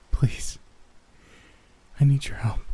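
frail plea broken by a long pause; a weary child mildly straining for each word; straining, exhausted. A narrator's reading. Exhausted girl begs a stranger for help, straining. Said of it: "straining on every word".

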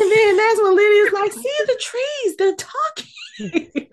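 very high, squealing through laughter; laughing retelling. Retold or imitated. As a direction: crack up while mimicking the child. Cracking up retelling Lydia's line.

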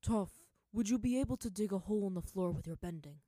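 loud, urgent father question; father asking his daughter to dig. A narrator's reading. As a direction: kneel and ask urgently. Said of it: "asked her father".

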